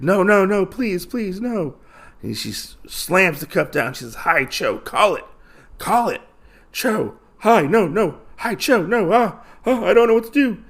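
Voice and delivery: high, panicked pleading; freaking out. Retold or imitated. Gambler begging the swordswoman not to kill him, high panicked pleading. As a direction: beg for your life, voice climbing high. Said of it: "And he freaks out".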